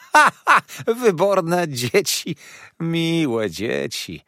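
loud high laugh, then slow drawn-out dzieci; cackling dwarf lady. Acted. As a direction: cackle and savour each word slowly. Karlica śmieje się z chłopców. (z głośnym śmiechem, powoli)

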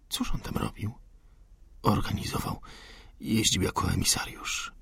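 soft hushed exchange; whispering. Acted. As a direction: keep voices down. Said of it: "Szeptali jak najciszej".

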